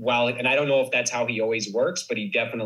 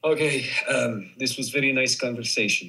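put-on Polish-accented goodbye. Shown as a demonstration. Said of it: "with a Polish accent".

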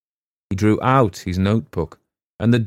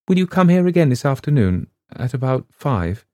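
plain reading voice

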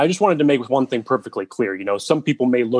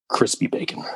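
whispered callback; 4 dB quieter than usual. Acted.

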